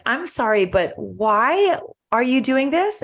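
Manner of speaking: imitating someone baffled by unexpected kindness; retold bewilderment. Retold man puzzled by kindness.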